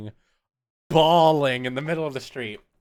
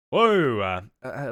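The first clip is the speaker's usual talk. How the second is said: normal talking voice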